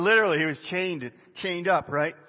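ordinary talk, nothing marked

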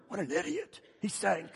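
lightly acted derision; mocking aside. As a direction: imitate onlookers scoffing under their breath. Acting disciples mocking from the boat.

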